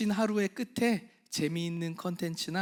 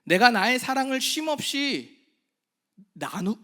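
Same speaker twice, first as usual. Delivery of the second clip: fervent sermon climax fading at the end; impassioned preaching; 2 semitones higher, 6 dB louder than usual. Acted. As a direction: preach it with full force, building.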